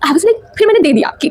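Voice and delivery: ordinary talk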